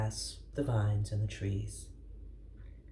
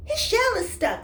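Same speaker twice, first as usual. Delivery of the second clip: much higher, louder strained voice; straining call; 8 dB louder than usual. Acted. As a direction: call it while pushing hard. Rat straining at the chair.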